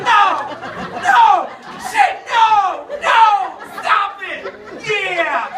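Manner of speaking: loud hammed-up no, no; mock yelling at book. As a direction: shout at the page like it's too much. Acting reading Fifty Shades, yelling.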